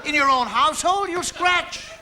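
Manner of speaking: usual speaking voice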